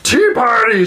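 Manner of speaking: high, loud burst of joy; troll thrilled to be invited; eager troll. Acted. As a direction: blurt it like a delighted giant.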